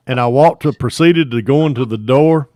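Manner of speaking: ordinary talk, nothing marked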